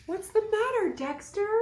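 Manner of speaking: high, caring question; lightly voiced worried friend; concerned friend. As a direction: ask gently what's wrong. Roxy character voice.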